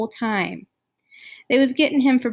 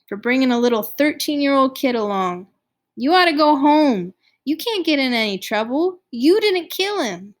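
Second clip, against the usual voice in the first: pleading; 5 semitones higher, 5 dB louder than usual. A narrator's reading. Johnny urging Ponyboy home.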